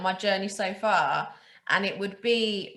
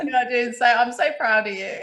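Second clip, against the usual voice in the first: louder, said through real laughter; laughing; 3 semitones higher, 4 dB louder than usual. Real, not acted. Host praises guest for not swearing, laughing. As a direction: tease her fondly while laughing.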